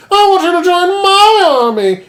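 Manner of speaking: high, loud, overeager invitation; hammed-up eager recruiter. Leader begging Guts to join, shrill-high and loud. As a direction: squeal the offer like a fanboy.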